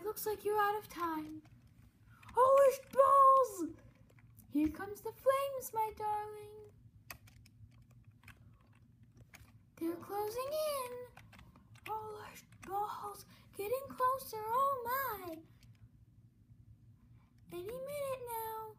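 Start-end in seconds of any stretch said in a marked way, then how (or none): 2.3-3.7 higher, loud panicked shriek; full-volume alarmed yell; panicked scream. Acted. Flames closing in. 4.5-18.8 higher, showy villain host voice; higher flamboyant host taunting his darling; gloating robot host. Acted.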